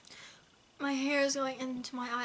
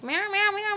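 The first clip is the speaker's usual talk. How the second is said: higher mock meowing; imitating cat meows; 8 semitones higher, 4 dB louder than usual. Owner mocks her chatty cat, a mock meow. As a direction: mimic the cat's nagging meows. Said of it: "He's like".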